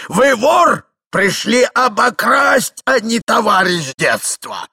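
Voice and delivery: громкое протяжное обвинение; обличай во весь голос, растягивая слова; крик старика. A narrator's reading. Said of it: "кричал старик". (гневно, высоко)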